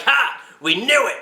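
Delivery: triumphant gotcha shout. Acted. Aliens catching a pedophile, triumphant gotcha. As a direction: catch them in the act. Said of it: "they're like".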